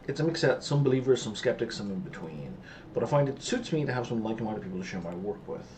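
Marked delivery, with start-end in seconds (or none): none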